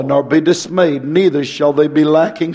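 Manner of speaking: usual speaking voice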